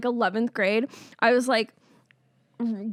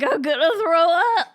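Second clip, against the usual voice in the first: laughing nausea; 5 semitones higher, 6 dB louder than usual. Retold or imitated. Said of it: "you're like".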